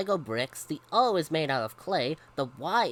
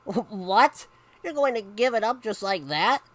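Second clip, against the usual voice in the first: higher, louder disbelief; incredulous questioning; astonished; 5 semitones higher, 5 dB louder than usual. Acted. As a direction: react like a mom bowled over. Mom astonished, nearly fell over.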